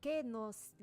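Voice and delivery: slow Spanish verse recital; Spanish recitation. Shown as a demonstration. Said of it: "That's Spanish from Miguel de Cervantes".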